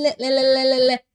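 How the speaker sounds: "le" repeated with a tongue-tip L; clear L. Shown as a demonstration. Teacher drilling the Spanish L in del. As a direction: tap a clear L on each "le". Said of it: "Esse L aqui".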